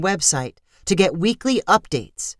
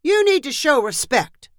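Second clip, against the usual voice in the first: higher-pitched insistent scolding; scolding parent; 8 semitones higher than usual. A narrator's reading. Father telling pirate kids off, high insistent scolding. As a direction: scold the kids from below the ship.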